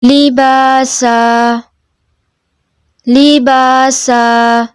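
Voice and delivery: slow melodic tajweed chant; Quran recitation. Shown as a demonstration. Teacher modelling the verse for the student in slow chant. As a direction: recite slowly, drawing out each vowel.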